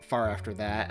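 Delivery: normal talking voice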